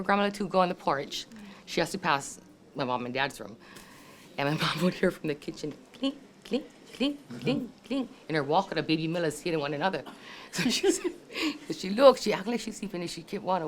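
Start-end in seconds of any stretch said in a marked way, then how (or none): 6.0-7.7 high, light clinking noises; small high-pitched clinks; imitated clinking. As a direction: mimic cans knocking together, lightly. Imitating cans clinking in her walker.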